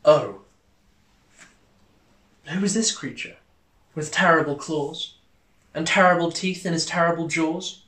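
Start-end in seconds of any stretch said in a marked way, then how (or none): none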